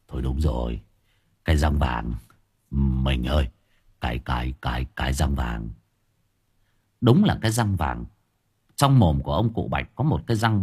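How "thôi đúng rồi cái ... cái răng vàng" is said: low, stammering, shaken voice; stammering husband. A narrator's reading. Husband stammers to his wife, low and shaken. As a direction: stutter it out in shock, voice dropped low. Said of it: "nhìn vợ lắp bắp".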